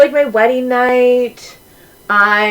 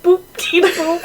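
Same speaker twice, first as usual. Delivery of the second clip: higher, giggling, nose plugged; laughing nasal; 8 semitones higher, slower than usual. Shown as a demonstration. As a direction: laugh it out holding your nose. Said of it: "keep your nose plugged".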